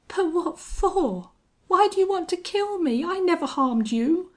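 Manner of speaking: very high, frightened plea; high, panicky bewilderment; desperate pleading. A narrator's reading. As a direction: plead for your life, pitch way up. Doomed man pleads with his killer.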